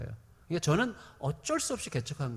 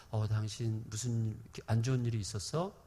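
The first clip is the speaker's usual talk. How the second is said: gentle concern; 7 semitones lower, 6 dB quieter than usual. Retold or imitated. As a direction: ask the wife kindly, easing her. Gently asking upset wife.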